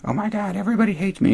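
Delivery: high squeaky woe-is-me falsetto; mock self-pity. Host imitating Emma complaining to Kieran, mock-pitiful falsetto. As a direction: wail it in a mocking high voice. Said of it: "It's like".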